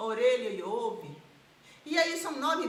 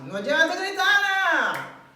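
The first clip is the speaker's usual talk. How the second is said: much louder, higher scolding yell; public-hospital doctor yelling at a patient; 6 semitones higher, 10 dB louder than usual. Retold or imitated. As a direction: shout her down like a curt doctor. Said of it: "ele vai falar assim".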